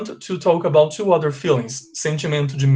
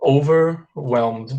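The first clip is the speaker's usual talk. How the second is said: OVERWHELMED said slowly in two parts; slow model pronunciation; much slower than usual. Shown as a demonstration. Teacher shows students a new word, slowly and carefully. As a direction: draw out "Overwhelmed", pausing after "over".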